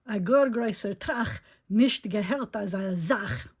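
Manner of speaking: slow, loud rhyming read; story reading in Yiddish. A narrator's reading. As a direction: read the Yiddish verse slowly. Reads Yiddish rhyming verse.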